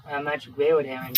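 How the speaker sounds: ordinary talk, nothing marked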